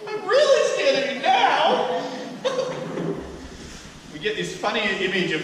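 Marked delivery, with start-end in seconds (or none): 0.0-2.1 high, loud, slow hammed-up rebuke through laughter; laughing mock rage. Preacher playing the master's rebuke, laughing and loud. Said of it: "he was scared". 2.1-4.1 unvoiced giggle; breathy laugh. Real, not acted. Preacher laughing at his own acted rebuke.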